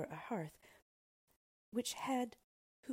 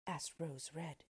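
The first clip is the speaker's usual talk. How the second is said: plain reading voice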